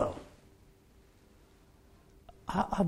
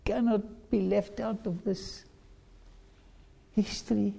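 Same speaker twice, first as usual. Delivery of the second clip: mild acted anguish, desperate pleading; pleading cry; 5 semitones higher than usual. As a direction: beg the Lord not to leave you out. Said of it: "Listen to their cries".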